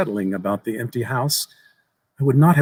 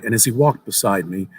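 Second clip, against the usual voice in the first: plain reading voice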